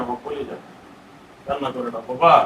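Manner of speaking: very soft, low comforting murmur; tender soothing. Real, not acted. Father soothing crying child. (খুব আস্তে, নিচু গলায় আদরমাখা সান্ত্বনা)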